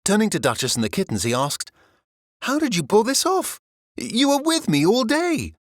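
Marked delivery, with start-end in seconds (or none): 2.4-5.5 amazed. A narrator's reading. O'Malley asks, amazed.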